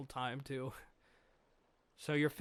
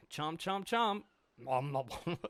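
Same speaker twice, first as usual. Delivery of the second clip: eating noises. Acted. Eating sound effects from panel.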